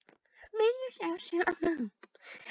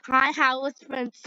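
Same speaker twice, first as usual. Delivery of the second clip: normal talking voice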